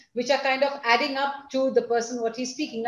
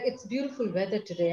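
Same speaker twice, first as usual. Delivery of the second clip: softer, lower polite remark; calm and soft; 4 semitones lower, 6 dB quieter than usual. Shown as a demonstration. Teacher showing a calm voice at a formal meeting, soft. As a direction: keep it restrained, as in a formal meeting. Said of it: "I can very happily sound like".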